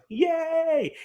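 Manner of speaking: high squealing yay; cheer. Real, not acted. Host cheering the kids.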